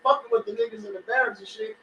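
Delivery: excited retelling. Retold or imitated. Retelling soldiers plotting revenge.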